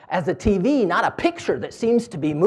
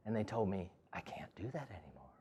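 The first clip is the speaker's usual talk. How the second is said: softly whispered, sad admission; whispered confession; 8 semitones lower, 21 dB quieter than usual. Retold or imitated. Gertrude tells the speaker she was banned from painting, whispered. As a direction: let it fade into a whisper.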